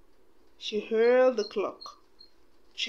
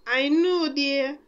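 put-on high, slow kindly old lady voice; soothing old godmother, then brisk orders; fairy godmother comforting and bustling; 5 semitones higher, 4 dB louder, slower than usual. A narrator's reading. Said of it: "the fairy godmother replied".

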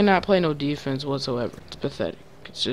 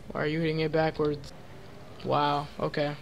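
ordinary talk

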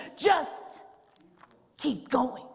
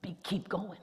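hushed refrain; 7 semitones lower, 12 dB quieter than usual. Acted. Dropped to near whisper.

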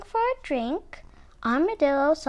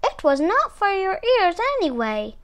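higher-pitched taunting call; 6 semitones higher than usual. A narrator's reading. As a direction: call it out, lightly raised voice. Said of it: "Muskrat called out".

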